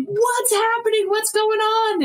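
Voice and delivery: high-pitched bewildered cry; mock alarm. Acted bystanders confused by fight.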